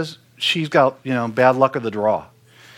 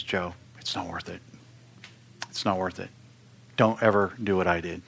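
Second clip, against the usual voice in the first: hushed, much softer, a touch of sorrow; retold quiet regret; 6 semitones lower, 8 dB quieter than usual. Quoting a fallen pastor's warning to Joe, hushed and rueful. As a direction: pass on a broken man's warning softly. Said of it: "all he would say to me is".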